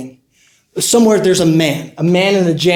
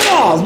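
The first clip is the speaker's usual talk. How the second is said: high "hyah" of effort; acted martial-arts cry; fighting yell; 4 semitones higher than usual. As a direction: yell as the blow lands. Acting out his blow.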